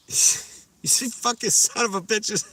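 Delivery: high, squeaky imitation of a Cuban friend's accent; buddy cursing them out in a high mock Cuban accent. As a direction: swear at your buddies in a high Cuban-accented voice. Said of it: "Cuban accent".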